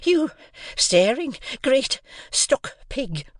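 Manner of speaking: high insult broken into winded pieces; out of breath. A narrator's reading. Sister abusing Joe after shaking him, out of breath. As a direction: spit it out word by word, short of breath. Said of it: "out of breath".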